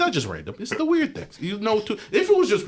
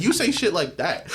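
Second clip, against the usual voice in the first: ordinary delivery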